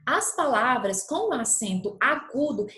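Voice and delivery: usual speaking voice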